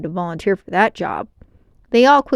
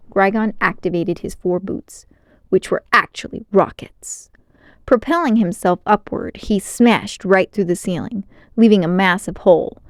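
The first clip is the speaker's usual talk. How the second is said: plain reading voice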